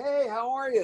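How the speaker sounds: high, retold delighted hello; excited greeting. Actor realizes who is calling, high excited greeting. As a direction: re-enact a startled, eager phone greeting.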